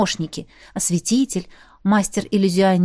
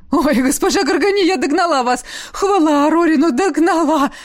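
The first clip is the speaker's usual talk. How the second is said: higher, breathless gabbling; gnome chattering; 7 semitones higher, 3 dB louder than usual. A narrator's reading. The gnome Velita catches up with Gorgani, gabbling breathlessly. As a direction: rattle it off out of breath. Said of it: "затараторила Велита".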